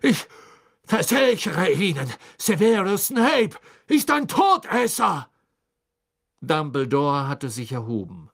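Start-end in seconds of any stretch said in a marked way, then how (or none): 0.0-5.3 desperate shout. A narrator's reading. Karkaroff chained, desperate.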